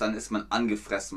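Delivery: ordinary talk, nothing marked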